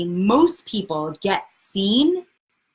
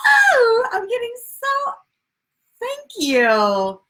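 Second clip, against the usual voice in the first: high squealing cry of delight; excited squeal; 3 dB louder, slower than usual. Real, not acted. Greeting viewers on her livestream, squealing with excitement. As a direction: squeal as a favourite viewer arrives.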